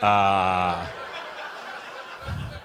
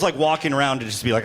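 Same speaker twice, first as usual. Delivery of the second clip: ordinary talk, nothing marked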